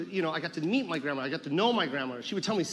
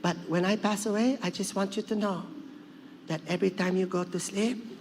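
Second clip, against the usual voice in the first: put-on accented grandmother, firm then measured; Lola Tinay. Grandmother prepares grandson for her passing, insistent put-on voice. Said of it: "She's like".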